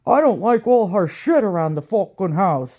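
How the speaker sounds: put-on Brooklyn accent, imitating an old man; mock Brooklyn boyfriend voice. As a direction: mimic a grumbling old Brooklyn guy. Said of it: "That's how he talks. He's from Brooklyn".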